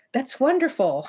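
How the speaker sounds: cheerful reassurance. Acted. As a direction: cheer her up before tears come. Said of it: "Hanya said cheerfully".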